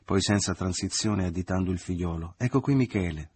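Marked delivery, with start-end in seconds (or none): 2.4-3.3 raised, higher announcing voice; exclaimed, short of a full shout; crying out. A narrator's reading. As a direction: announce someone loudly to the room. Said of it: "gridò".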